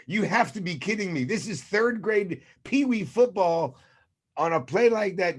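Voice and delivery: you can't believe what you're seeing; incredulous disbelief. Retold or imitated. Fan yelling at the TV in disbelief, exasperated. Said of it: "I was like".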